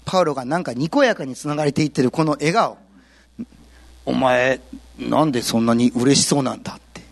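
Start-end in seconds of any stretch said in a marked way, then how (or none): 4.0-6.7 low, gruff mock question; lightly voiced bystander, baffled; puzzled onlooker. A bystander asks grinning Paul, low and gruff.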